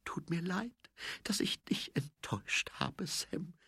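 high, very soft whisper; breathed apology. A narrator's reading. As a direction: barely breathe out an apology to a husband. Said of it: "hauchte sie". (hoch und leise gehaucht)

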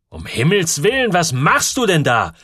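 loud, high alarmed call. A narrator's reading. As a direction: shout a warning across the room. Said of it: "hatte der Barfußmann gerufen". (erschrockener, lauter Ruf)

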